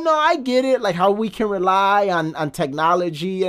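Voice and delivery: lightly voiced calm young woman; quoting Mila Kunis. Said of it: "she's like".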